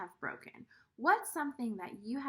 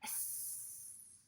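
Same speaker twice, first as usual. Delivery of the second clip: long snake hiss; drawn-out s like a snake; 18 dB quieter than usual. Shown as a demonstration. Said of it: "Everybody make a hissing sound like a snake would make".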